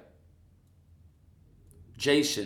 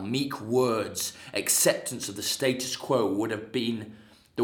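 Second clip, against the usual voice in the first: loud, contemptuous rebuke of a lover; a cold husband lecturing, voice raised; scornful Jason; 2 semitones higher, 6 dB louder than usual. Acted. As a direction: Jason berating Medea with bitter scorn. Jason rebukes Medea, acted monologue.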